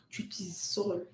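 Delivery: usual speaking voice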